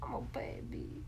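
soft whispered Vine line; a whispered repeat of a Vine quote to a friend. Retold or imitated. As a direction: breathe it out as a whisper.

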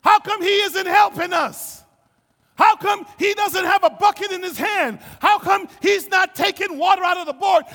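loud, high, indignant outcry; acting out desperate, accusing shouts; frantic shouted demands. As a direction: yell over a storm, demanding help. Voicing frantic disciples in the storm.

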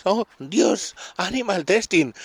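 high mock horror, hammed-up; mock outrage. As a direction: parody a shocked activist.

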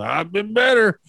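bloodied drunk answering the cop, lightly voiced; wry drunk reply. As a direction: shrug it off wryly.

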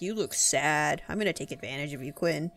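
creepy weirdo. Retold or imitated. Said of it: "some weirdo just shows up".

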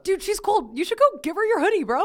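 high, loud teasing bro voice. Pretend. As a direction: play a pushy frat bro egging him on.

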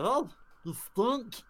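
high, drawn-out cartoon jibe; silly shared voice. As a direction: stretch it out in a squeaky creature voice. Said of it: "this is the voice that me and Laura do".

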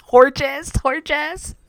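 high, incredulous repeat between laughs; tickled, high-pitched questioning; giggling disbelief. Real, not acted. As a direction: squeal HORGES as a question, cracking up. Giggling over coined name.

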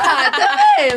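squealing high through laughter; laughing. Real, not acted. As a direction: giggle the words out high. Laughing at friend's joke.

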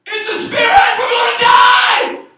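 very high, loud acted scream of terror; panicked shriek. Preacher acts disciples in the sinking boat, panicked shriek. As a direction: shriek it slowly in hammed-up panic.

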